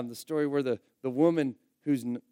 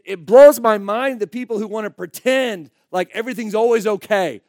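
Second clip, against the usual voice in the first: loud, heated, higher-pitched preaching; worked-up and forceful; real anger; 4 semitones higher, 10 dB louder than usual. Real, not acted. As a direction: vent real exasperation from the pulpit. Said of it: "I'm angry".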